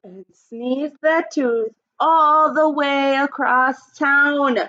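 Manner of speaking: put-on expressive read-aloud for young kids; storybook reading. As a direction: read it like story time for the class. Teacher reads picture book aloud.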